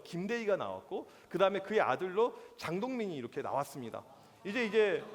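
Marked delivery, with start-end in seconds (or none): none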